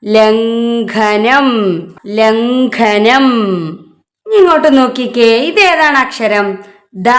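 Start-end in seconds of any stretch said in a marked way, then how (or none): 0.1-3.3 ലംഘനം very slowly, syllable by syllable; slow, drawn-out model reading of ലംഘനം; reading demonstration. Shown as a demonstration. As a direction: hold each syllable of ലംഘനം for learners. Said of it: "ഇപ്പൊ എന്താ വായിക്ക?".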